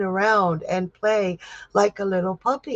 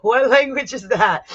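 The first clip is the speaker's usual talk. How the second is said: amused; 5 semitones higher, 5 dB louder than usual. Real, not acted. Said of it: "I keep saying flacked".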